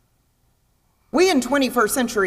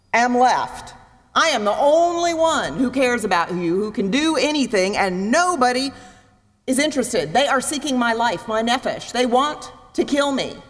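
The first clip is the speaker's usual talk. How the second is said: whining Elijah; 4 semitones higher than usual. Retold or imitated. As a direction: whine like a self-pitying prophet. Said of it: "Elijah starts to whine".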